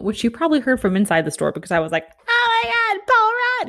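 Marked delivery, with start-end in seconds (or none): 2.3-3.7 shrieking high and loud; starstruck scream. Retold or imitated. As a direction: squeal his name like a starstruck fan. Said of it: "I scream".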